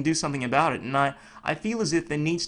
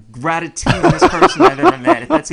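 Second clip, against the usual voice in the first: laughing; 12 semitones higher, 12 dB louder than usual. Real, not acted. Jokes about a made-up word.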